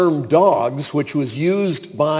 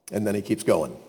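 usual speaking voice

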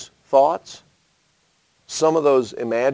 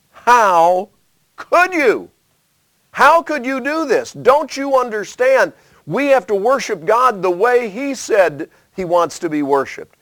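much higher, louder, pleading; anguished plea; 9 semitones higher, 5 dB louder than usual. Retold or imitated. Western tribes confronting brothers over the altar, high anguished plea. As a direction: cry out in hurt disbelief. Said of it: "They came in and they said".

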